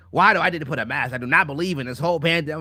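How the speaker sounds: lightly imitating a complaining customer; mock indignant Karen. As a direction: rattle off a mock protest, fast. Mimics a complaining anti-mask Karen.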